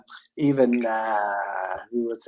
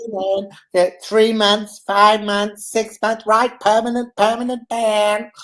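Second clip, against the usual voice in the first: higher, whiny imitation; Dan's whiny voice; 7 semitones higher than usual. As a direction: whine it out, pitched up. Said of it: "you had to have Dan's whiny voice".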